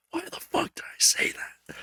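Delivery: soft, voiceless whisper to himself; horrified whisper. Retold or imitated. Hearing his own content back, horrified whisper. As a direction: whisper it in mock disbelief at yourself. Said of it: "and I'm just like".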